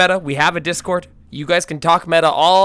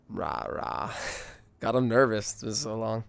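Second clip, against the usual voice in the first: usual speaking voice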